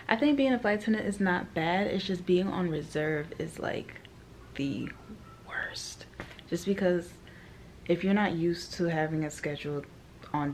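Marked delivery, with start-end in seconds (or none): 3.4-7.1 whisper. Real, not acted. Whispered complaint about reserve.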